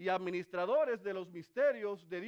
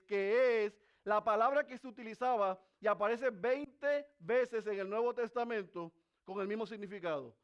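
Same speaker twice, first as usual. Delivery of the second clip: ordinary delivery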